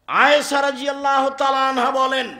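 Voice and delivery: loud, high hadith recitation; fervent preaching. Acted. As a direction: proclaim it to the gathering. Narrating hadith loudly.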